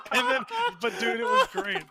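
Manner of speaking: shrieking laugh into high, loud excited talk; laughing hard. Real, not acted. Laughing at his own story, high and loud with glee. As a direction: crack up, then blurt it out still laughing.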